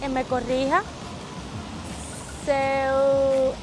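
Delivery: slow, careful learner Portuguese; slow motion. Real, not acted. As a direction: spell each word out slowly. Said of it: "Perdónenme que estoy hablando muy lento".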